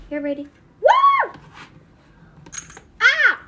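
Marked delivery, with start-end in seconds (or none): 0.8-1.3 very high, loud woo; piercing held whoop; whooping shout. Pretend. Brady is handed food, whooping shout. Wordless cheer on getting food. 3.0-3.4 high, loud yelp; short scream. Pretend. Food gets dropped, short scream. Sudden cry as food drops.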